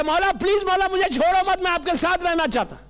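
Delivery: impassioned acted begging; loving plea. Ibrahim begging the Imam out of love, loving plea. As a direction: plead urgently, rushing at the end. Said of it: "یہ محبت میں بول رہا تھا".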